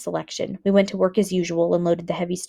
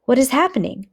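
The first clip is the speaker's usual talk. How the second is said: afraid; 6 semitones higher, 3 dB louder than usual. Acted. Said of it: "Suddenly I was afraid".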